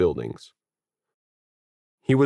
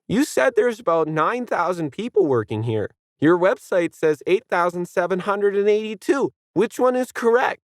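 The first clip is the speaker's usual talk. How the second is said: put-on much higher bratty kid voice; demanding kid; 10 semitones higher than usual. A narrator's reading. Kid challenging the guide, demanding. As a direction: demand an answer, pushy. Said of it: "the kid demanded".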